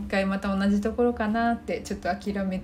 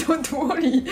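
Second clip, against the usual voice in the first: laughing retry; 5 semitones higher, slower than usual. Shown as a demonstration.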